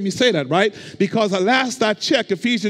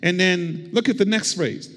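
ordinary talk, nothing marked

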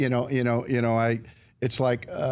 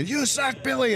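high-pitched mock jeer; crowd heckle; 12 semitones higher than usual. Fans who really hate him heckling the pitcher, high jeer. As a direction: taunt him like a fan in the stands. Imitates hateful crowd again.

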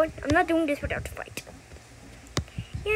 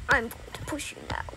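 whispering; 3 semitones lower, 4 dB quieter than usual. Acted. Sneaky whisper while playing.

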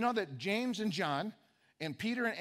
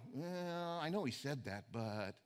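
hesitant mumble; 5 semitones lower, 8 dB quieter, slower than usual. Retold or imitated. Imitating a doubting believer.

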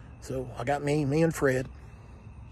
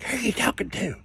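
suspicious mother; 9 semitones higher than usual. Retold or imitated. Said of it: "So she's like".

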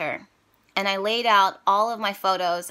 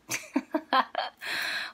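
teary laugh; 7 semitones higher, 5 dB quieter than usual. Real, not acted. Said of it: "trying not to cry".

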